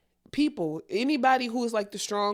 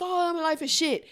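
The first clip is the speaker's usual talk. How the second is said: whiny venting friend; 7 semitones higher than usual. Retold or imitated. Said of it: "It's not people coming to you like".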